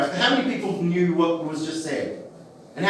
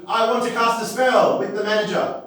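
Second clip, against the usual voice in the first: higher-pitched demanding complainer; Karen; 4 semitones higher than usual. Acted. Karens giving their house motto, high demanding voice. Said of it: "And the Karens would be".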